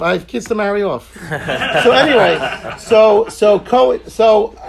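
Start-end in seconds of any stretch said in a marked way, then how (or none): 1.1-4.5 laughing through the words, louder and slow. Real, not acted. Laughing off the joke about his old surname, louder and slow. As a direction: laugh it off and push on.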